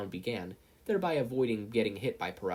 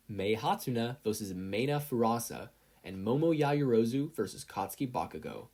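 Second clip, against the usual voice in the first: ordinary delivery, nothing marked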